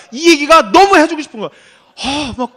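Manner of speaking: ordinary talk, nothing marked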